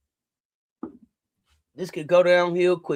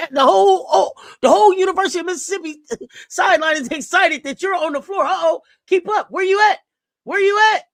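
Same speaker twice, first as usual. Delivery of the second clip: much higher and louder, laughing through it; overjoyed hype for the player; excited cheering; 8 semitones higher, 6 dB louder than usual. Real, not acted.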